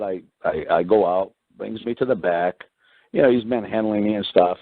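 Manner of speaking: normal talking voice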